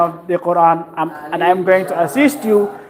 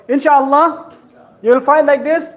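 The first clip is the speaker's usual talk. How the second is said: ordinary delivery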